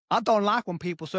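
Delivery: ordinary talk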